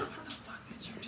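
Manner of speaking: unvoiced whisper in shock; hushed. Dad whispering to son in tense meeting, hushed. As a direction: whisper it across the room. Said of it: "my dad looks at me and goes".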